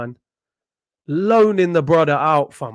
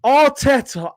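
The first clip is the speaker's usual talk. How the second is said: normal talking voice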